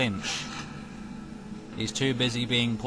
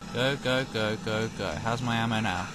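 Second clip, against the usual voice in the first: ordinary talk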